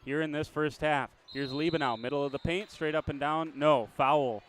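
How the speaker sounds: brisk live game call, rising with the action; play-by-play. Acted.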